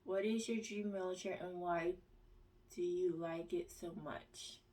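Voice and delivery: ordinary delivery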